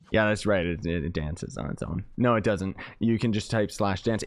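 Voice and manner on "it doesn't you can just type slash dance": ordinary delivery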